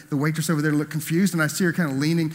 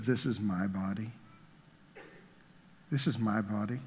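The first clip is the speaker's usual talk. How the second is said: much softer, lower solemn quote; reverent hush; 5 semitones lower, 9 dB quieter than usual. Acted. Preacher quoting Jesus at the table, hushed and reverent. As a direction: hush down for the sacred words.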